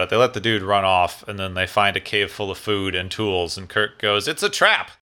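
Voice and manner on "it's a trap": hammed-up exclamation of alarm; mock alarm. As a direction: imitate a dramatic warning, pitch leaping up. Kirk's retold warning in story.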